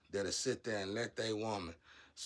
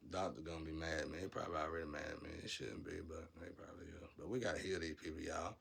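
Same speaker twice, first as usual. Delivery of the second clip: muttered aside; 6 semitones lower, 9 dB quieter, faster than usual. Real, not acted. Muttered aside about doctor.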